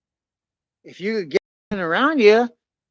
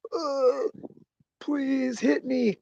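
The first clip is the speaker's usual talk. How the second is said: desperate slow plea; 9 semitones higher, much slower than usual. Retold or imitated. Begging the wave while drowning.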